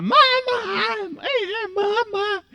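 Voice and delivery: high, stuck talking-doll call; eerie broken-toy doll; creepy doll voice. Retold or imitated. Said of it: "parecia a voz de assombração".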